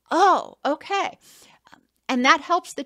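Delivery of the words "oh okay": put-on higher, slow childlike "oh, okay"; child's realization. Small child discovering the toy fits, slow higher childlike voice. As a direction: voice a small kid getting it. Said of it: "and they're like".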